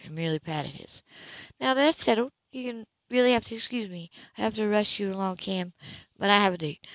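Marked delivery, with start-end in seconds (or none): 1.6-6.9 higher, cool woman's voice, caught off guard; higher, cooler woman's voice to Cam at her door; Anna's voice. Acted. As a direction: play the composed woman surprised at her door. Anna dismissing Cam.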